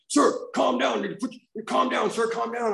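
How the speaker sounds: very high mock cop yell; imitated cop commands. Cops ordering a worked-up passenger down, high mock yell. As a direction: bark high-pitched orders, acting out the cops. Retold cops confronting him at airport.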